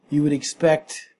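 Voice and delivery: normal talking voice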